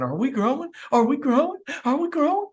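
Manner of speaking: imitated nagging, rising to a high squeak; nagging repeated question. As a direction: keep asking, each one pitched further up. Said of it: "keep asking".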